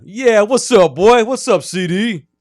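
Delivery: high, loud, exaggerated put-on greeting; mocking put-on voice. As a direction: ham up a coach's fake hip voice.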